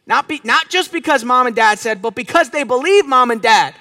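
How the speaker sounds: building preacher's cadence, rising in pitch and force; forceful preaching. Real, not acted. Preacher on kids knowing who they are, building fervor. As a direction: drive home each repetition with a punch.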